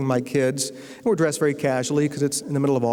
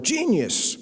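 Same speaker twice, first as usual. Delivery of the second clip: a higher-pitched mild mock yell of genius; 9 semitones higher than usual. Retelling the chairman's outburst to the audience: mild mock yell. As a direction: toss off a replay of the chairman's shout. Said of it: "the guy who yelled out the word".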